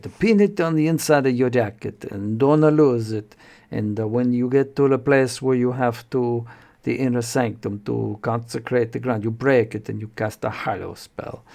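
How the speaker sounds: hammed-up Mediterranean-accented priest giving instructions; Inigo Montoya. Priest instructs the wizard on the talisman, exaggerated Mediterranean accent. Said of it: "exaggerated, like, uh, Inigo Montoya".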